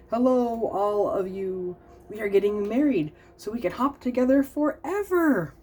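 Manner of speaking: excited. Acted. Announcing their wedding.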